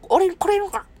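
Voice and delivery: Aogaeru impression. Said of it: "青ガエル".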